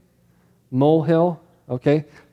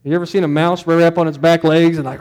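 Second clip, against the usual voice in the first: ordinary talk, nothing marked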